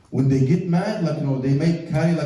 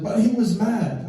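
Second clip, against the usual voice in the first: higher, mock-insistent wife's objection; protesting; 6 semitones higher than usual. Wife interrupts the sheikh to excuse her husband, protesting. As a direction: plead the excuse to the judge.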